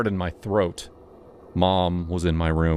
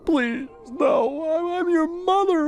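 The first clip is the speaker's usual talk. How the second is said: sobbing plea; slower than usual. Acted.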